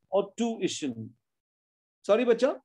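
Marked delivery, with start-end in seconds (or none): 0.3-1.1 TUITION slowly as tu-ish-un; three syllables. Shown as a demonstration. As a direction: draw tuition out into its separate beats. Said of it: "Tuition has three syllables".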